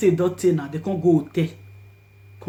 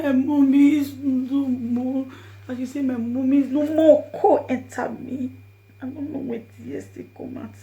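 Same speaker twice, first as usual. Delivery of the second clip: tearful; 4 semitones higher than usual. Retold or imitated. Narrator tearfully retelling the daughter's words. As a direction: let tears come as you repeat her words. Retold daughter's news, tears.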